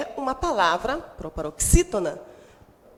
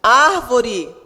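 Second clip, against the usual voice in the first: "Árvore" with a loud opening Á; stress on Á; 6 dB louder than usual. Shown as a demonstration. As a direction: punch the first syllable of "Árvore". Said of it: "a sílaba tônica é o a". ("Árvore" com o Á bem forte)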